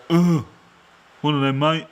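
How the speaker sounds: mock lazy ordering, high and loud; grunt, then order bluntly; grunted pub order. Tourist ordering a pint without French, mock grunting order. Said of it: "Or just go".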